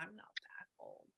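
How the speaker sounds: a soft unvoiced whisper; whispered spirit reply. Voicing the spirit's age denial in a whisper. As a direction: breathe it out as a secret aside. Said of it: "She goes".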